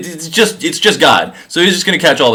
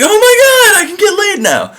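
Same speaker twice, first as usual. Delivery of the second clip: much higher, squealing delight; giddy excitement. Retold or imitated. Adam seeing Eve for the first time, squealing with excitement. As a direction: burst out like a thrilled teenager.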